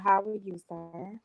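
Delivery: normal talking voice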